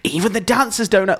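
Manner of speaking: high, put-on amazement; mock audience suspense. Podcaster voices viewers hooked by the event, high mock amazement. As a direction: play the agog viewer. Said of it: "you want people to go".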